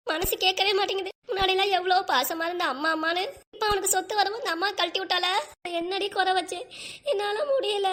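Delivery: high, tearful wail; acted crying. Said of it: "அக்கா, அழாதக்கா".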